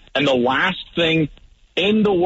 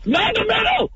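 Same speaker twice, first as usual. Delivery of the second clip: high, strained panicked scream. Retold or imitated. Quarterback warning his receiver off the middle, screaming. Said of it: "I'm screaming".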